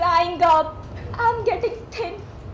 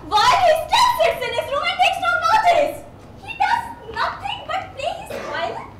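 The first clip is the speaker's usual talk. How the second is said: bitter laughing; 9 semitones higher, faster than usual. Acted.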